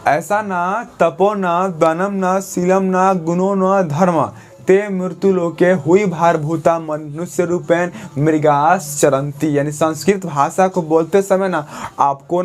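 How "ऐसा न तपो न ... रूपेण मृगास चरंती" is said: slow, measured chanting recitation; slow motion. Shown as a demonstration. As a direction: recite the shloka slowly, word by word. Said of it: "अब हम इसको slow motion में बोलेंगे".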